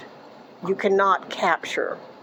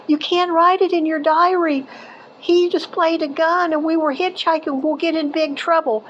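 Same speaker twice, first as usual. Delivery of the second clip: put-on worried young girl; 9 semitones higher than usual. Friend Debbie's worried warning.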